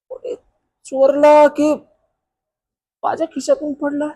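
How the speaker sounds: high, whining complaint; hammed-up woeful account; plaintive distress. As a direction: pour out the loss in a high wail. Tells police of the lost phone.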